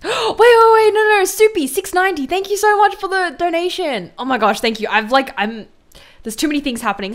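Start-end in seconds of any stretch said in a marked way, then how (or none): 0.0-4.1 high, loud, flustered burst of gratitude; surprised thanks. Real, not acted. Streamer thanking a donor, startled and excited. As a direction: gasp and blurt it out high.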